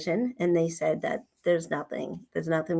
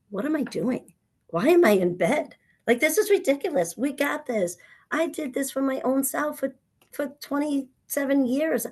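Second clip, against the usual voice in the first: higher, quicker rallying of herself; a mock pep talk to herself, pitched up; fired-up self-talk; 6 semitones higher than usual. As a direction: talk yourself out of bed with growing energy.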